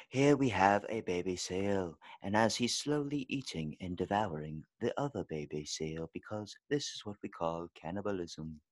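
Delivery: put-on British nature-documentary voice; UK documentary narrator. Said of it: "I almost did my U K impression".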